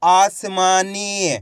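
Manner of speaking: आसमानी very slow, vowels held; blended word demo. Shown as a demonstration. Teacher joining letters for pupils, आसमानी drawn out. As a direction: stretch आसमानी syllable by syllable. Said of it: "तो मिलके बन जाएगा".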